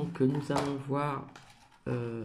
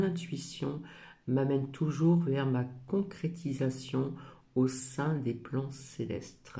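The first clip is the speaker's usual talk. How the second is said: reads the oracle card's message aloud, a little louder and measured; reading a card; 2 semitones higher, 4 dB louder than usual. Acted. Reading her oracle card's message aloud to viewers, gently solemn. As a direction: read the card text as an affirmation.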